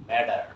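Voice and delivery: "ম্যাটার" with a soft D in the middle. Shown as a demonstration. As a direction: say "ম্যাটার" as "mad-der". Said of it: "এটা ড হবে উচ্চারণটা". ("ম্যাটার"-এর ট-কে ড বলে)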